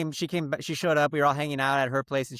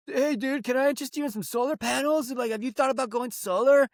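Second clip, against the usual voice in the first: pushy salesman; 10 semitones higher than usual. Retold or imitated. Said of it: "And he goes".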